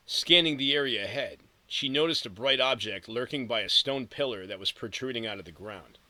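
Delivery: ordinary delivery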